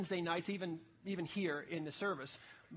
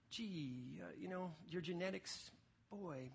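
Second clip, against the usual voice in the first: very soft mock sympathy; said nicer; 11 dB quieter, slower than usual. Shown as a demonstration. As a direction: pretend to break it to them gently. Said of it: "let me say it nicer".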